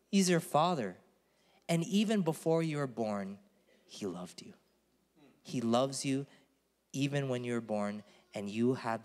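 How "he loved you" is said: soft and tender. Acted. Tender climax of sermon.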